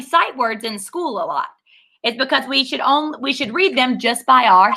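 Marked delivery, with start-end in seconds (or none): none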